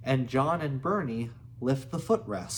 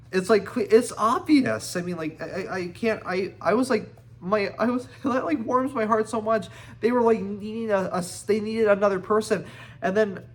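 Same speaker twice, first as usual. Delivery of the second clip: higher, louder, stumbling with excitement; overjoyed gushing; 8 semitones higher, 6 dB louder than usual. Real, not acted. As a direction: gush about it, too moved to finish sentences. Reader gushing about the rescuer.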